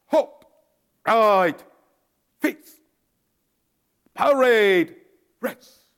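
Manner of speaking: high, loud drawn-out drill calls; drill commands. Retold or imitated. Private halting platoon at chow hall, loud drill calls. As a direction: sing out each command across the yard. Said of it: "I said".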